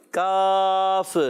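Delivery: کاف held long with a false bounce at the end; demonstrating a wrong echoing کاف; wrong qalqala. Shown as a demonstration. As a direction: stretch کاف and add a wrong echo. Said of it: "یہ بھی غلط ہو گیا".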